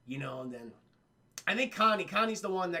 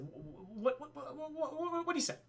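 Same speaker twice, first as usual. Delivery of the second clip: stunned stammer; 10 semitones higher, 5 dB quieter than usual. Retold or imitated. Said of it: "He's like".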